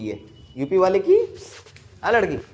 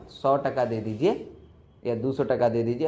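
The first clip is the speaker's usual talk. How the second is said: Bihari seller; 7 semitones lower, 5 dB quieter than usual. Retold or imitated. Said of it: "सबसे पहले उसको बोलिएगा".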